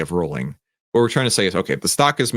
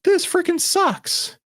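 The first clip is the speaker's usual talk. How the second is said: exasperated rant. Retold or imitated. Retelling her losing trade to viewers, high mock exasperation. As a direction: groan out the frustration, hammed up. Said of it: "And I was like".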